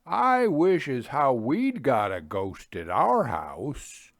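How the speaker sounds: slow, dialect-tinged countryman; lightly voiced Lincolnshire local; rustic villager. A narrator's reading. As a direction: muse it slowly like an old farmer. Native's enigmatic parting remark.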